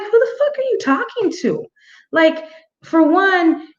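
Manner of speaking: indignant comeback. Retold or imitated. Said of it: "I was like".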